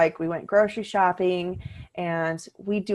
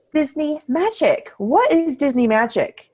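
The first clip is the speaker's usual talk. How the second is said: excited announcement; 10 semitones higher, 4 dB louder than usual. Real, not acted. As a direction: unveil the topic like a show host. Announcing the week's topic.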